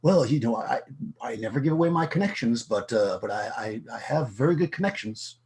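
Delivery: flustered dealer tripping over his words; halting, uneasy character voice; nervous stammer. Acted. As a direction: stammer defensively, restarting phrases. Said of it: "he stammers and says".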